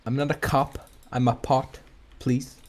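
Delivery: ordinary talk, nothing marked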